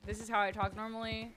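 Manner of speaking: normal talking voice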